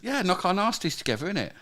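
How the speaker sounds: put-on high-pitched cockney geezer accent; mock London accent. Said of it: "do it in your accent".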